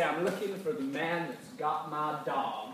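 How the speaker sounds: slow, mock cowboy drawl. Shown as a demonstration. Leader demos the cowboy line, slowly drawled. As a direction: strut in and drawl it like a gunslinger. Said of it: "you're gonna cowboy strut".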